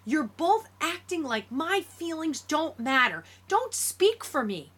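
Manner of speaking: high-pitched indignant protest; loud, mad outburst. A narrator's reading. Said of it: "Talking that loud must hurt".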